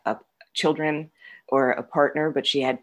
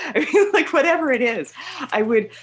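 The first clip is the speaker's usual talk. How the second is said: laughing; 11 semitones higher, 6 dB louder, faster than usual. Real, not acted. Laughing at herself.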